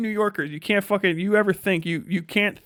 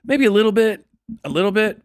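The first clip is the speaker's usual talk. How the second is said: a little bit, pitched up and loud; emphatic hedge; 6 semitones higher, 7 dB louder than usual. Real, not acted. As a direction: push the hedge higher and louder. Joking hedge, loud and high.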